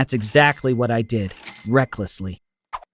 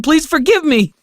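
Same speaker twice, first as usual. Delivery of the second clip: much higher, desperate plea; begging; 13 semitones higher, 4 dB louder than usual. Acted. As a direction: push the plea up high, begging. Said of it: "I begged".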